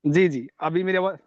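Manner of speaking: ordinary talk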